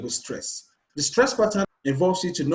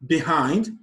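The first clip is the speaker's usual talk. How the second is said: "Behind" with weight on "hind"; stress on second syllable. Shown as a demonstration. Teacher giving an example of stress pattern, "Behind" stressed on "hind". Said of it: "stress is placed on the syllable hind".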